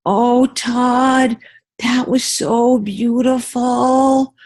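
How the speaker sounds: put-on high, slow, gushing girl's voice. Girl in class gushing to Todd, high and slow. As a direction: coo it like a moved schoolgirl. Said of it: "one of the girls goes".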